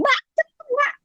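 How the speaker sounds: very high-pitched call to the helper; squeaky, hammed-up urgency; re-enacted hurrying call. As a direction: mimic your hurried request up high. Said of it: "kok ngomongnya berubah".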